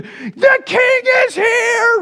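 very high, stretched-out yell; acted wild shout, way up high. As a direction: holler the news while running through town. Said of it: "it's a yelling campaign".

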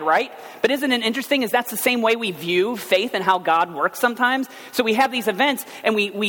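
high-energy pulpit preaching; fervent preaching. Acted. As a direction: push it to the congregation like a revival sermon. Preaching at full force.

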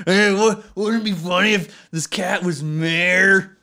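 loud, slow goofy stoner voice; stoned. Acted. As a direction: drag it out like a giddy stoner. Voicing stoned townsfolk.

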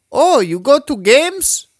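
high, slow, goofy exaggerated voice of a bug-eyed kid; silly exaggerated kid voice. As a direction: stretch it out like a clueless kid. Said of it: "he did not talk like that".

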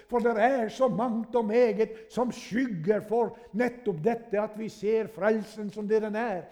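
high, loud revival sermon voice; fiery preaching. Acted. Preacher urging the congregation to cry out, fiery preaching.

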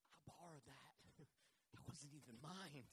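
low, hushed, small voice; sheepish admission. Acted. Prophet telling Elisha about the borrowed axe, hushed and sheepish. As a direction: own up softly, voice sinking.

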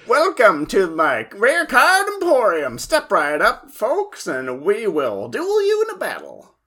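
slow, high Southern shopkeeper drawl; Southern popcorn salesman. Retold or imitated. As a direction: sell it like a folksy Southern store owner. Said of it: "runs a novelty popcorn store in the South".

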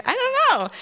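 very high, squeaky, sheepish; squeaky I don't know. Retold or imitated. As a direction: squeak it out, embarrassed. Sheepish admission about Job.

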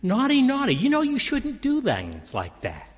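a limp, mocking scold of a child; hammed-up feeble parent tut-tutting. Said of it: "father didn't say".

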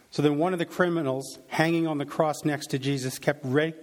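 normal talking voice

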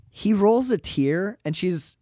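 usual speaking voice

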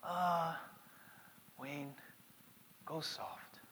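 put-on quiet, hushed Korean-accented father. Korean dad advising his pastor son, soft Korean accent. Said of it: "He said in his Korean accent".

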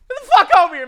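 high, very loud order; yelled summons. Pretend. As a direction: holler to fetch someone over. Yelling for the guest.

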